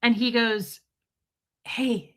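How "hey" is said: imitating an awkward doctor, whispery; a half-whispered mock greeting; lightly voiced doctor easing in; doctor impersonation. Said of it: "I'm gonna impersonate exactly how".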